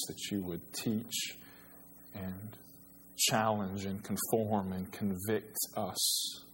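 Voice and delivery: soft, hushed prayer voice; bowed, reverent prayer before the sermon. Acted. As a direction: pray it quietly and humbly. Pastor leads opening prayer.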